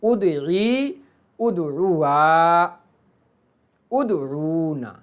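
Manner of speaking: drawn-out chant of verb forms; slow drilled recitation. Shown as a demonstration. Teacher reciting forms slowly to the class. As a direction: stretch each form slowly for students. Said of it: "teacher reciting conjugation".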